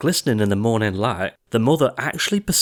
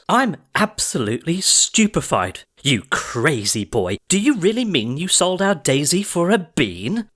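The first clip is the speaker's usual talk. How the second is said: high, outraged shriek of disbelief; shocked cry; 7 semitones higher than usual. A narrator's reading. Mother learns the cow went for one bean, high shocked cry. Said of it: "leaped high into the air and cried".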